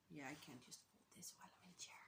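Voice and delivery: whispering. Real, not acted. Folding shawl, muttering aside.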